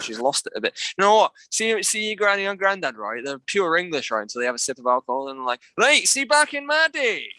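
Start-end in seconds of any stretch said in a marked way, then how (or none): 5.8-7.3 put-on high, slow tipsy Scottish old-timer; drunken grandparent in a put-on Scottish accent; mock Scottish accent. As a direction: ham up a Scottish granddad after a drink. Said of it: "They sound right Scottish".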